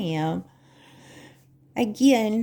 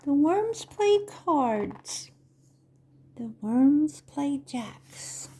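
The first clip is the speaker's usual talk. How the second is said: slow, sing-song picture-book reading; Vorlesen; 3 semitones higher, slower than usual. Acted. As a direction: read to little children, stretching the words with big pauses. Reads picture book to children.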